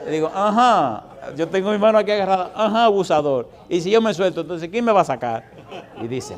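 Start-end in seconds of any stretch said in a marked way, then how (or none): 0.3-5.5 higher, louder taunting reply with a laugh at the end; defiant, triumphant. Retold or imitated. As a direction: laugh in the face of the tempter. Defying the ugly creature.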